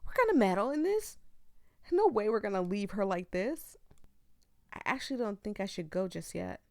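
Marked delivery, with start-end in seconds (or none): 0.1-3.8 determined. Real, not acted. Streamer vowing to viewers to help Lala, loud and determined. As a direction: declare it like a vow. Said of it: "Oh, but".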